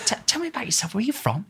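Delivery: high, breathy camp whisper; a camp pantomime dame leaning in close. Retold or imitated. Retold dame voicing a front-row punter.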